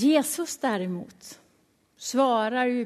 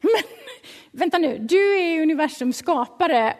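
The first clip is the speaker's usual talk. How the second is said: flustered, higher and stumbling protest; mock panic; 5 semitones higher, 4 dB louder than usual. John is asked to baptize Jesus, mildly panicked protest. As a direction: act out a mild panic. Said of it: "inte bara ställd utan ganska panikslagen".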